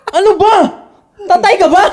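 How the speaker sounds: high, loud outburst in mock annoyance; kunwaring pasigaw na inis; mock irritated shout. Acted irritated snap at partner's reactions. (pabirong galit na sigaw)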